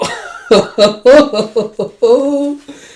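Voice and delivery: high, loud burst of laughter; real delighted laugh, pitched high. Real, not acted. Reader reacting to a love scene, laughing.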